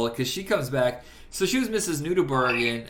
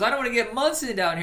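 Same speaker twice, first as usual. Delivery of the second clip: higher, fast mock character line; movie character quote; 10 semitones higher, 3 dB louder, faster than usual. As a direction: rattle it off in a put-on voice. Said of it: "he goes".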